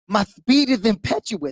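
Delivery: lisping boxer impression, s turned to th; Mike Tyson. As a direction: imitate the boxer with a thick lisp. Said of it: "Tyson goes like this".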